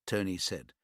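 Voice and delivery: ordinary delivery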